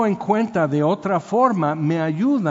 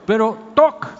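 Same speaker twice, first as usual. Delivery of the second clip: knock sound; 6 semitones higher, 5 dB louder than usual. Retold or imitated. Mom's smack sound.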